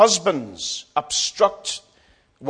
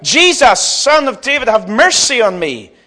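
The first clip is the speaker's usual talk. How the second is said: loud, higher cry for mercy; calling out; 6 semitones higher, 6 dB louder than usual. Acted. Blind beggar calls out to Jesus for mercy, calling out. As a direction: cry it out over the crowd, drawn on the key words. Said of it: "He called out".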